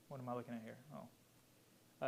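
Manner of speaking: very soft, under the breath; muttered aside. Real, not acted. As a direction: mumble to yourself while checking your notes. Checking his notes mid-talk.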